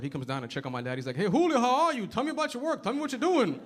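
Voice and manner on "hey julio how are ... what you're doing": high-pitched singsong landlord caricature. Retold or imitated. Landlord checking on the handyman's work, high caricature voice. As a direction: question him in a put-on high, lilting voice.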